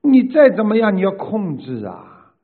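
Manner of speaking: loud, forceful rebuke in bursts; stern scolding. Real, not acted. Teacher telling a follower to control their temper, stern loud scolding.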